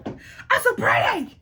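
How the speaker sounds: squealing giggle, then a slow high "I'm so pretty"; mock-vain, laughing at herself; high laugh into vain brag. Real, not acted. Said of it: "I'm stunning".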